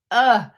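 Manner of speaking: loud exasperated ugh; a sudden loud groan of disgust. Retold or imitated. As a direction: let out a fed-up groan. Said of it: "makes me like".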